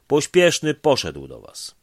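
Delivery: high, loud call down the line. Acted. Dispatcher calling the next station, loud and high. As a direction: shout it down the phone line.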